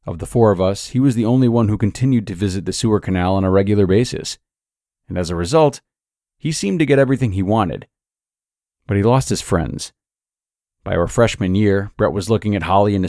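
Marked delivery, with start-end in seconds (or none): none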